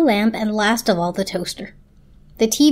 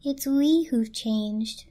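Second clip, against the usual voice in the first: slow, softer, miserable; miserable blanket; 4 dB quieter, much slower than usual. A narrator's reading. The blanket answering the lamp, slow and miserable. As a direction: sigh it out, a little hopeless. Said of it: "said the blanket miserably".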